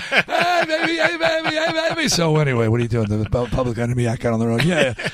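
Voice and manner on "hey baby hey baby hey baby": put-on high, sing-song call to a child with a laugh; a laughing dad cooing at his daughter on the ride; doting dad calling. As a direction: wave and coo at your kid going by. Waving at kids on merry-go-round.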